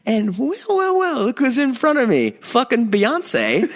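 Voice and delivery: high, laughing disbelief; a sky-high, giggling "look who it is"; excited disbelief. Real, not acted. Spotting [name] in first class.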